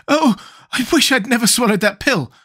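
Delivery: high-voiced lament; pained regret.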